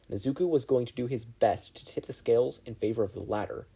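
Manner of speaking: ordinary delivery, nothing marked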